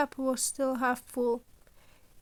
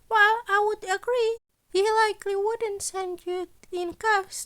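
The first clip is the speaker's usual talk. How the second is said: higher, louder voice for the cheerful scheming principal; principal Nezu; 8 semitones higher, 4 dB louder than usual. Acted. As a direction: play Nezu brightly pleased with his impossible demands.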